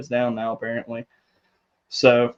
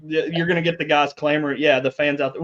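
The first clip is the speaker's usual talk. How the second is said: normal talking voice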